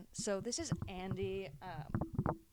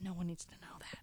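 conspiratorial stage whisper; 10 dB quieter than usual. Pretend. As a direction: whisper it like a secret. Joking secret aside on air.

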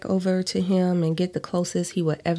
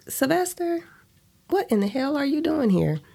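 shocked; 10 semitones higher than usual. Acted. Startled greeting to ex.

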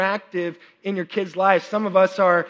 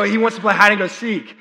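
ordinary delivery

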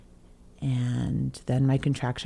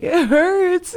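much higher, louder crying complaint; 6 dB louder than usual. Retold or imitated. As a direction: wail it out, half laughing at herself. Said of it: "I was crying".

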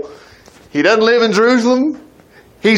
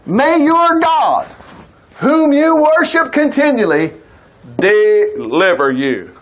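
solemn proclamation; 4 semitones higher, slower than usual. Acted. King blessing Daniel at the lions' den, solemn proclamation. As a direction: proclaim it grandly, drawing out each phrase.

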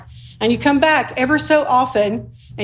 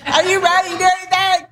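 high, calling out across a distance; a retold, hammed-up shout from the back of the bridge; mock yell from afar; 7 semitones higher than usual. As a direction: call it out like he's far away. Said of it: "he's yelling from the back".

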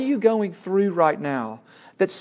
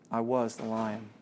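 quiet revelation; 6 semitones lower, 10 dB quieter than usual. Acted. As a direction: let it land gently. Aslan reveals himself.

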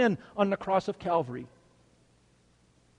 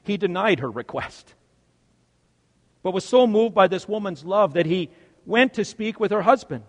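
ordinary talk

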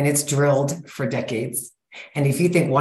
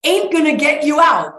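emphatic; 7 semitones higher, 5 dB louder than usual. Real, not acted. Emphatic coaching.

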